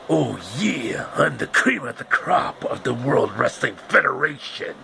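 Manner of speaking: gravel-free hammed-up wrestler promo; Macho Man voice. Macho Man Randy Savage cutting a promo at the show, hammed-up wrestler voice. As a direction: growl out a cocky wrestling promo.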